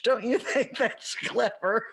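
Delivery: giggling through the words; laughing at own joke. Real, not acted. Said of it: "I'm laughing at my own joke here".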